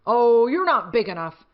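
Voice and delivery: a grouchy little bug waving off a rival, loudly; grouchy ladybug. Acted. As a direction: scoff at the bigger bug, loud and proud. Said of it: "said the grouchy ladybug".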